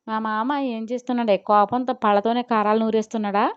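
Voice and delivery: ordinary delivery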